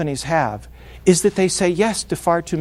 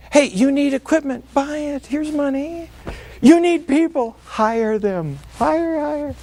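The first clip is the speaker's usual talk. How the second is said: carefree big spender; 7 semitones higher than usual. Retold or imitated. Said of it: "they get loose".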